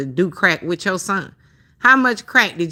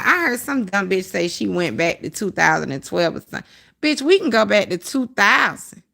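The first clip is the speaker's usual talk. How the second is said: normal talking voice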